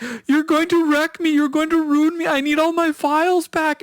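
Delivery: high-pitched, hammed-up tearful plea; mock crying. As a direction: wail it as an imitation of a desperate victim. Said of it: "The other guy starts to cry".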